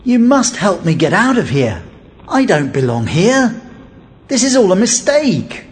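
urgent prisoner's appeal; insistent, beseeching voice; pleading. A narrator's reading. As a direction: beg to be let out, rising at the end. Prisoner begs servant boy for help.

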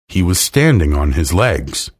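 usual speaking voice

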